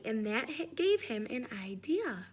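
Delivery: plain reading voice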